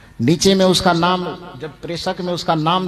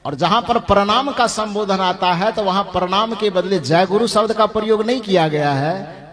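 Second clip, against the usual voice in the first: ordinary talk, nothing marked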